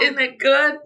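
high, loud laughing "Good"; playful preacher cry. Pretend. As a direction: answer like a preacher firing up the room. Playing preacher after church joke.

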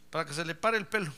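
ordinary delivery

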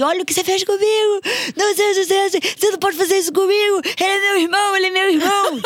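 high, loud, fast mock yell of a whiny brother; hammed-up shrill brother tantrum. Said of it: "gritava comigo".

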